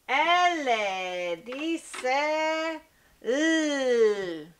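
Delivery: L dice l, holding the l very slowly; letter sound demo. Shown as a demonstration. (pronunciación lenta del sonido de la L)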